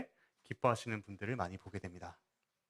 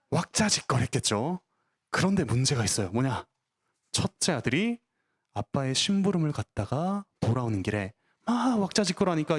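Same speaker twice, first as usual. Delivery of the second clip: put-on children's sermon; 3 semitones higher than usual.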